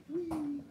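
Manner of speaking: very high, drawn-out whee; high-pitched gleeful squeal; playful squeal. Pretend. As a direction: squeal it high like a little cheer. Playful cheer at finished dish.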